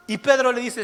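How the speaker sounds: ordinary delivery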